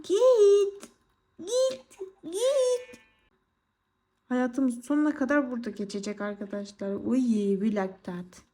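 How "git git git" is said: much higher, panicked laughing shoo; squealing shoo. Real, not acted. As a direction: squeal at it to go away. Shooing the monster away. (yüksek sesle panik içinde kovalama)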